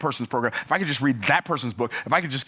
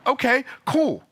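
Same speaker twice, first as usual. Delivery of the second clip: sarcastic cool; 10 semitones higher, slower than usual. Real, not acted. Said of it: "not, not cool, but cool".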